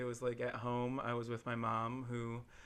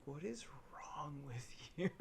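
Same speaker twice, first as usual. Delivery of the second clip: whisper; 3 semitones higher, 5 dB quieter than usual. Pretend. Mock disbelief at TV-less people.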